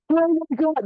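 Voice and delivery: high-pitched mock disbelief; imitated shocked workers. Workers watch their boss catcall, imitated high-pitched shock. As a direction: gasp it as the astonished crew. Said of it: "the men on the site are like".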